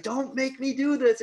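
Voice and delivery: high, loud begging; mock desperate plea. Cop pleads during the countdown, high loud mock desperation. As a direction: imitate a cop pleading at gunpoint.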